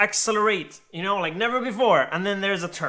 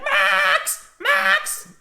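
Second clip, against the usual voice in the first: put-on very high frantic old lady calling out; squeaky old lady calling Max; calling the dog; 5 dB louder than usual. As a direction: call for the lost dog in panic. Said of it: "she's like".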